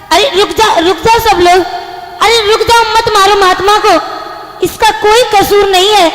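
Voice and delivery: urgent plea. Acted. Woman stopping villagers from shooting the holy man, urgent plea. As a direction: call out to halt them, pleading.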